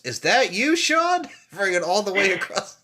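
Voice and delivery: high-pitched mock swoon; swooning show quote. Host quoting a TV show to co-host, high swooning squeal.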